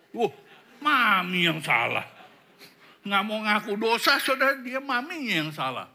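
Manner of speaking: loud, high mock protest; indignant blaming. Child blaming Mami to father, loud indignant protest. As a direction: imitate an indignant complaint at full volume.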